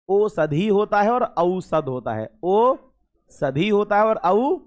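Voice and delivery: "औषधि" and "औसत" spelled out slowly, stretching the औ; slow careful pronunciation. Shown as a demonstration. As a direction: dictate each word slowly, syllable by syllable. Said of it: "याद रखना". (धीरे-धीरे साफ़ उच्चारण)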